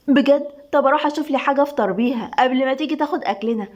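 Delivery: ordinary delivery, nothing marked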